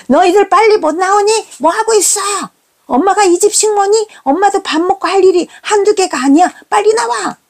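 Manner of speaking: very high-pitched furious mother act; escalated screechy scolding. Retold or imitated. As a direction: push the voice right up, at the end of patience. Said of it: "제 목소리는 점점 올라갑니다".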